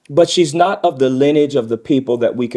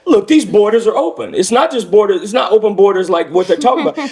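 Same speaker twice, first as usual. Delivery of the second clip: high, louder mock politician reasoning aloud; imagined Miss Harris; 8 semitones higher, 4 dB louder than usual. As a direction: argue it high and insistent, in someone else's role. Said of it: "that allows Miss Harris to say".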